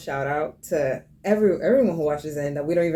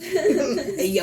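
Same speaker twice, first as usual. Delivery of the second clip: angry New Yorker; 8 semitones higher than usual. Pretend. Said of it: "That's how angry New Yorkers sound".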